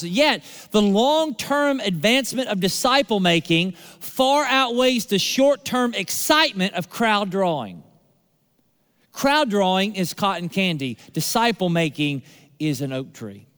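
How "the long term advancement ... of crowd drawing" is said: ordinary talk, nothing marked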